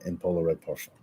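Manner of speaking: a lightly put-on, badly faked foreign accent on "Polar Red Parfum"; terrible accent. Reading a viewer's scent name aloud, mock accent. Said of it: "that's a terrible accent".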